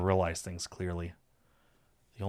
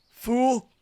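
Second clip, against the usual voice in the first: high, loud mock insult; pouting retort; 7 dB louder than usual. A narrator's reading. Saphir answering his teasing lover, pouty. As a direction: scold your teasing lover.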